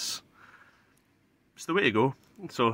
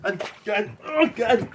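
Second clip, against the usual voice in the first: high excited shout; 11 semitones higher, 4 dB louder than usual. Real, not acted. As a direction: shout high and loud from the cold. Psyching up in ice water.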